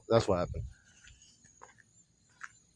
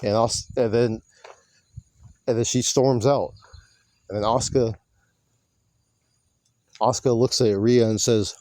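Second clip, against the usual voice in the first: ordinary talk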